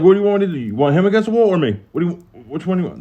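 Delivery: high, rushed pestering questions; hyper cellmate. Retold or imitated. Cellmate asking the guards what they want, hyper. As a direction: imitate an over-eager cellmate rattling off questions. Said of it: "he's just high energetic".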